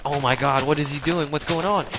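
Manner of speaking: stunned crowd, voiced in rising alarm; startled bystanders. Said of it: "They're like".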